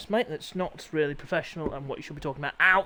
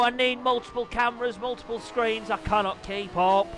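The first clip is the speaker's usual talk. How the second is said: lively play-by-play commentator voice; upbeat live bike race commentary; 4 semitones higher than usual. Acted. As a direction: call the race like a TV motorsport commentator.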